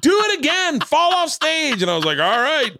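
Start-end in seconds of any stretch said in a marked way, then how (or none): 0.0-1.8 hammed-up woman yelling, last word stretched; heckler's demand. Heckler eggs him on. 2.2-2.8 high-pitched, hammed-up surrender; comically high agreement; giving in. Gives in to the heckler.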